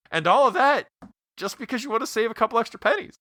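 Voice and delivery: high, fast frantic sales rant; Chris Farley rant. Retold or imitated. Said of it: "And he's like".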